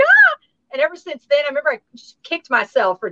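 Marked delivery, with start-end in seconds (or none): 0.0-0.3 high, loud mock gasp of awe; starstruck squeal. Retelling meeting a famous person at sixteen, starstruck squeal. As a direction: let out a much higher, louder starstruck "ah". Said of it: "I was just like".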